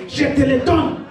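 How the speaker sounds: usual speaking voice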